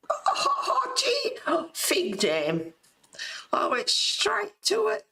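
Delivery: high, slow pleased gasp; light up at finding it; delighted surprise. Real, not acted. Finds the card at once.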